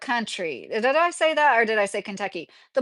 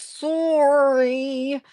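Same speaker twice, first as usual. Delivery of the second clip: long-held Canadian "soooor-ee" for "sorry", pitched up; Canadian accent; 4 semitones higher, 3 dB louder than usual. Retold or imitated. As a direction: exaggerate the round Canadian O in "sorry". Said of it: "word that Canadians say real funny".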